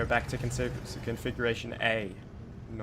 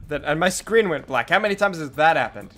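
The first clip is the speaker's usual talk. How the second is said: loud exasperated complaint; frustrated, almost yelling; 5 semitones higher, 8 dB louder than usual. Real, not acted. Game glitch frustration.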